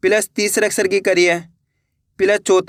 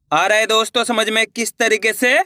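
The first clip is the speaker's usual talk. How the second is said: usual speaking voice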